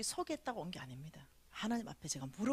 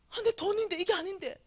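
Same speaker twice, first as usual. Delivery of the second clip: dismayed protest; 10 semitones higher, 5 dB louder than usual. Retold or imitated. Dismayed at her words.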